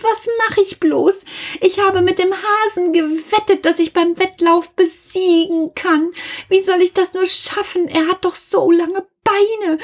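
very high, panicky wail of despair; desperate. A narrator's reading. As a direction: cry out for help in a high, frantic voice. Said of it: "rief der Igel verzweifelt".

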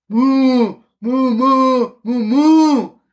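loud mooing cow. Acted. Cow mooing behind Kasperl, loud. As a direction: moo like a cow.

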